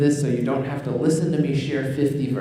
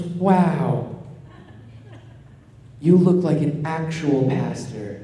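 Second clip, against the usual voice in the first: louder, drawn-out wow, then slow teasing wonder; mock amazement; 2 semitones higher, 5 dB louder, much slower than usual. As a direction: imitate an impressed mother, pitched up and stretched out. Mom's amazed reaction, acted quote.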